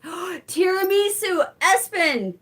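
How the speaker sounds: high, slow, thrilled exclamation; excited gasp. Real, not acted. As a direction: gasp and cry out the word. Gasps at favorite dessert.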